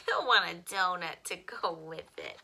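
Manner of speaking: put-on bright, lively read-aloud for children; expressive picture-book reading; storytime reading. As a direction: read it like storytime for little kids.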